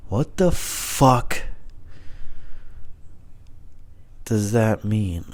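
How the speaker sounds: slow, drawn-out disbelief; long-paused, incredulous question; baffled. Real, not acted. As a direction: stretch it out in bewilderment. Baffled by own note.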